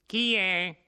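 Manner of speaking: high, loud call from behind the door; old woman calling out warily. A narrator's reading. The old woman answers the knock, calling out.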